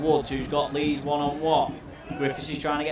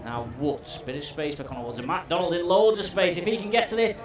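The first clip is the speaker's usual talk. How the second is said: ordinary talk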